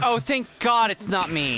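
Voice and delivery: loud, slow, high relieved cry; relieved shout. Acted.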